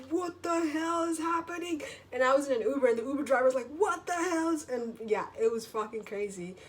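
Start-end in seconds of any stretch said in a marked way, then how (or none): 0.1-1.8 very high, bewildered cry; mock shocked shout. Witness watching the dog attack from an Uber, shocked. Acts own shocked reaction to fight. 3.8-4.7 high, startled outburst; mock shocked exclamation. Uber driver seeing the fight, shocked.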